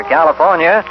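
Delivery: ordinary delivery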